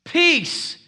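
loud, high command to the wind; commanding the storm. Retold or imitated. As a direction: order the sea to calm down. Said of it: "He got up and he said".

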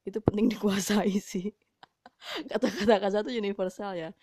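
laughing through the words while joking. Real, not acted. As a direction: chuckle all the way through the line. Joking about multilingual swearing. (sambil tertawa geli)

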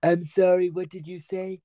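measured, carefully pronounced mock-dignified voice; put-on formal character. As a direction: answer in a stiffly formal put-on voice. Stays in put-on voice.

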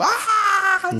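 long, high-pitched waking scream, hammed up; mock nightmare scream. Mocking film characters who wake from nightmares, exaggerated high scream. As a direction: scream as if jolted out of a nightmare, jokingly. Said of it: "você acordou gritando".

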